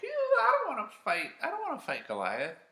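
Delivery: very high, fast whiny voice; scared soldiers whining; squeaky whining coward. Retold or imitated.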